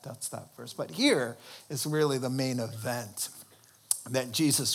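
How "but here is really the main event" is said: ordinary talk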